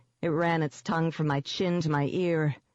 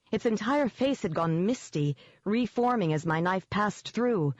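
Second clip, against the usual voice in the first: plain reading voice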